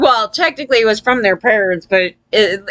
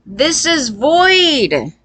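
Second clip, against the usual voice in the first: slower, higher mock repeat; 3 semitones higher, much slower than usual. Shown as a demonstration. Mocking sociopath logic for viewers, drawn-out mock tone. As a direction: drag out "void" on a raised, put-on tone.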